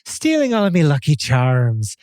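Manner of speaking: put-on Irish lilt; badly faked Irish brogue; terrible Irish accent. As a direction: do a hammed-up Irish leprechaun accent. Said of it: "my terrible Irish accent".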